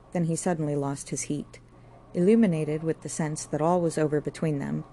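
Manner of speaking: usual speaking voice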